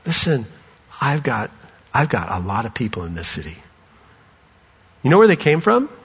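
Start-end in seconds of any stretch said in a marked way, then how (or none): 0.0-3.6 soft, low and quiet; gentle Jesus. Acted. Jesus speaking to the church, soft and low. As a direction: reassure them quietly. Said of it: "He'd say".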